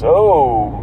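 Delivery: ordinary talk, nothing marked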